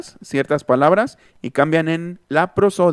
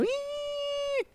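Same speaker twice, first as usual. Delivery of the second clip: put-on girl's whimper; 13 dB quieter than usual. Crying girl in Monterrey.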